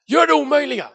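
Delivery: high, loud challenge; bold command. Acted. God telling us what to do, loud command. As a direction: call it out as a divine order.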